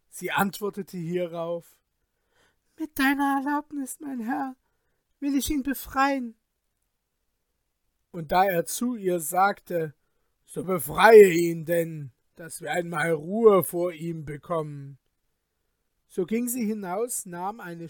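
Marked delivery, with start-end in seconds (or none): 2.8-6.4 high sorceress voice. A narrator's reading. Sorceress asks the king's leave. 10.5-15.0 loud impatient command. A narrator's reading. King orders her impatiently.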